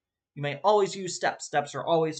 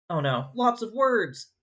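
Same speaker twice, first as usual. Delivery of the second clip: higher, drawn-out playful alarm; mock dismay; 7 semitones higher, 3 dB louder, slower than usual. Teacher sees a slide full of words, mock dismay. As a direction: feign dread at a wall of text.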